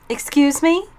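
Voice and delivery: mother shocked at the principal, sharp snap; indignant retort. A narrator's reading. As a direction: shoot it back, offended. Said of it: "she shot back at him".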